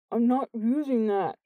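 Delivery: words thickened by swollen lips; thick-lipped speech. A narrator's reading. Jordan refusing the towel, thick swollen-lipped voice. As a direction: talk as if the lips are badly swollen. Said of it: "he said thickly through his enormous lips".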